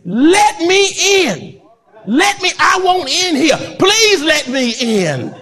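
high, slow pleading cry to be let in; pleading man. Retold or imitated. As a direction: beg to be let in, voice lifted high. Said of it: "He said".

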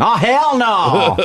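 high, slow sassy exclamation; sassy outrage. Acted. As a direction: belt out with sass.

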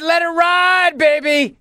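hammed-up high shout of a bettor; retold shout. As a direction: shout it like a gambler going all in. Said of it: "I said".

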